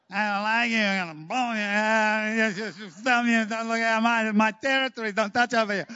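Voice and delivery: mock squabbling back-seat children; whining nasal chorus. People squabbling in God's back seat, mock nasal whining. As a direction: imitate bickering kids in a run-on whine. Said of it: "whining nasal twang chorus".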